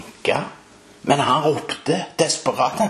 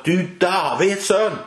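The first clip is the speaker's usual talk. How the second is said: high, forceful cry for attention; desperate shout; 8 semitones higher, 3 dB louder than usual. Acted. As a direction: call out desperately as the blind beggar. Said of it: "Han ropte det høyt". (høyt, desperat rop)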